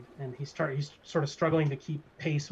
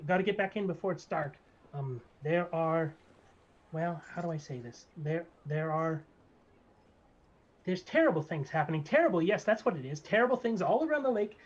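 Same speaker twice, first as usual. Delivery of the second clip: higher, nervous then proud little barber; gnome barber; 4 semitones higher, 3 dB louder than usual. Acted. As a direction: fret about danger, then boast your name. GM voices the barber.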